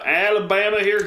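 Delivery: put-on a quick, clumsy Southern accent tossed in as a joke; terrible accent. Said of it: "That was a terrible accent".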